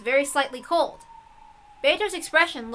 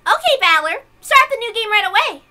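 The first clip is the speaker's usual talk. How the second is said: high, loud, impatient demand; bratty demand; 9 semitones higher, 6 dB louder than usual. Acted. Bored witch pushing the Game Master, bratty and loud. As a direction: whine at him to hurry up.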